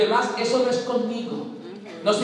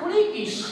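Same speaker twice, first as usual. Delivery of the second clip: high sudden "friquis" zap; sound effect; 12 semitones higher than usual. Acted. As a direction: snap it out as a death sound. Efecto sonoro de la muerte. ("¡friquis!" agudo y repentino, como efecto de sonido)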